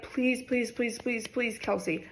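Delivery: soft, urgent string of pleas; retold hushed pleading. Friend begs her not to go to dad, hushed pleading. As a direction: imitate a friend begging under their breath.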